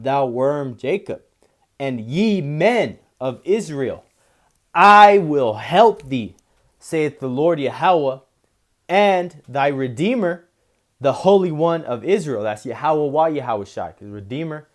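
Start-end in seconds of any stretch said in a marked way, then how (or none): 1.8-12.4 slow, solemn reading, louder at the start; scripture reading. Acted. Preacher reading the Lord's promise, slow and proclaiming. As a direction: proclaim the Lord's words slowly. Rereads the verse.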